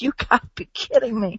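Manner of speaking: high, soft disbelieving aside; incredulous. Retold or imitated. Host recalls reacting to the trial, high and incredulous. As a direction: let the disbelief squeak out. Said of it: "I was like".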